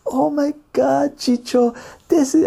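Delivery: high, drawn-out gushing; hammed-up squeal of delight; ecstatic festival girl. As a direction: imitate a blissed-out girl way up high. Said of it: "she's, like, going like this".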